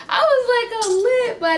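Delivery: high, laughing voice. Real, not acted. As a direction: say it through the laugh. Realizing he is high.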